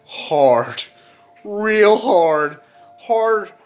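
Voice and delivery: loud, high, building emphatic cries; cracking up hard. Retold or imitated. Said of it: "I just start cracking".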